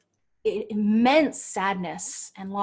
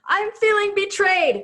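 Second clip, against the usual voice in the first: much higher, loud mock outburst; upset client; 11 semitones higher, 9 dB louder than usual. Coach playing a client who names her feeling, high mock-upset voice.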